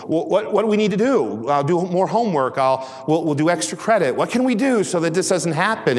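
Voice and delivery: high, pleading mock panic; imitated panicked parent. Parents begging the teacher to avert failure, mock panic. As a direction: plead frantically as a parent in crisis. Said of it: "they hit crisis mode".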